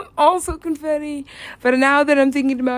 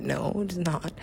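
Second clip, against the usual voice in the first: normal talking voice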